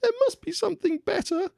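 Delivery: very high princess voice; cartoon princess. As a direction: squeak it like a little princess. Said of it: "said the Little Princess".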